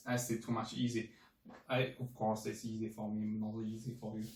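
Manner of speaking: normal talking voice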